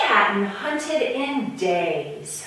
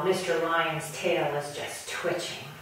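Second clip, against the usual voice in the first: plain reading voice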